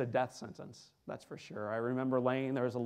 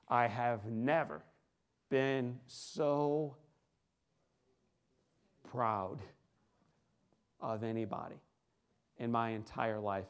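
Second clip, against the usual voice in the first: quiet, slow, softer father's words; tender pride; 3 semitones lower, 4 dB quieter, slower than usual. Retold or imitated. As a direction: let the pride come out gently.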